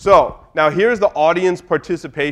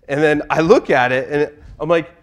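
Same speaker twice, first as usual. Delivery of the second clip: usual speaking voice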